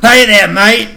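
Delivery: hammed-up Australian hello; Australian accent. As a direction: drunk party Australian accent. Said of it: "Max sounds like a drunk guy".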